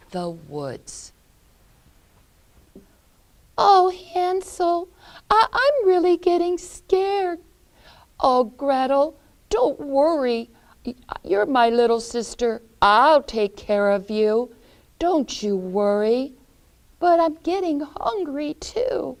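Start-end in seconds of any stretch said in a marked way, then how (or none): 3.6-7.4 put-on high, loud frightened girl voice; little Gretel scared in the dark woods; scared little sister. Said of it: "they started to get very, very scared". 8.2-16.3 lightly voiced boy, a bit higher and louder; a hint of a reassuring brother; big brother reassuring his little sister; brave big brother. 17.0-19.2 put-on high whiny girl voice; little Gretel complaining she is hungry; hungry little sister.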